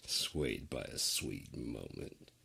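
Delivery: low sneer. Pretend. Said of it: "you thought I'd be swayed by a sweet".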